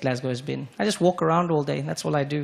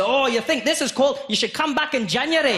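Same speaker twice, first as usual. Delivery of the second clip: put-on high Scottish accent, scoffing; a Glaswegian mocking the cold; Scottish local; 10 semitones higher, 3 dB louder than usual. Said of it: "He said".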